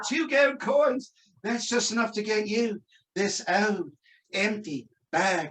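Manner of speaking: slow, drawn-out put-down; condescending shopkeeper. Acted. Shopkeeper belittling poor wizard's offer, slow condescension. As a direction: play a haughty seller, stretching each word. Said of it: "that was really condescending".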